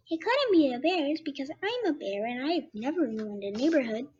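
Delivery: wavering pitch, rising and falling; fluctuating voice. Acted. Jingle the bear explains, in a fluctuating voice. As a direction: let the voice wobble up and down. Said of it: "the voice I'm gonna try to do for him".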